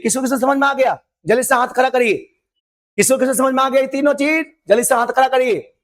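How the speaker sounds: usual speaking voice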